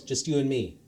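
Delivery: plain reading voice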